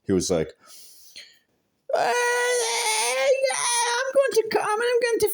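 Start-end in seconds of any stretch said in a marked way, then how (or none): 1.9-5.3 high, whiny mock-tough voice, like a scared kid pretending to be brave; mocking coward voice. Mocking the guy who ran from the fight, in a high whiny voice. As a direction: squeak it out like you're faking courage.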